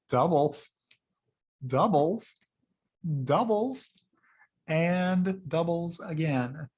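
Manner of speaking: stack the "doubles", pitch rising toward the end; building repetition. Real, not acted. Vlogger hyping Tesla growth to viewers, repeated words climbing in pitch.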